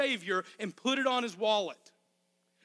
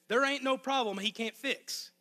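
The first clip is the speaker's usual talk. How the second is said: a touch of Arkansas country accent; 4 semitones higher than usual. Shown as a demonstration. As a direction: slip into a hint of home Arkansas talk. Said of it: "my accent was worse than usual".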